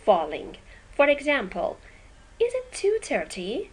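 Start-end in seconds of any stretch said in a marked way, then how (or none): 2.4-3.7 yes/no question rising, "is it two thirty" lifted high at the end; rising tune. Shown as a demonstration. Teacher demos a rising yes/no question tune.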